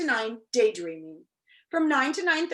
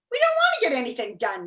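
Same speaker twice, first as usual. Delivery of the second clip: louder, clipped angry retort; angry snap; 6 dB louder, faster than usual. A narrator's reading. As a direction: snap it back in irritation. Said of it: "snapped another angrily".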